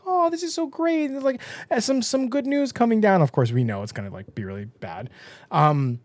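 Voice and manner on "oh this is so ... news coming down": high, sugary glowing voice; glowing sweetness. Retold or imitated. The glowing pregnant Guinea greeting good news, sugary and high. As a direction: beam it out, all sunshine.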